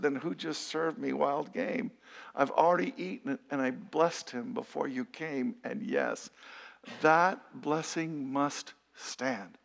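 slow, hushed, low reading of a shaken father; shaken old father, understated. A narrator's reading. Isaac to Esau on the stolen blessing, quietly shaken. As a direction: let the words sink, quiet and slow. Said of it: "began to tremble uncontrollably and said".

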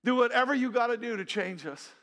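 loud, high re-enacted plea; emphatic plea. Preacher repeating the couple's key prayer, pleading emphatically. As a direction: drive the prayer home forcefully.

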